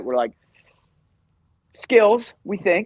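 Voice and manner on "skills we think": higher-pitched, tentative retold guess; mock-clueless reply; unsure answer. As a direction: offer it up as if guessing. Retold unsure answer about plans.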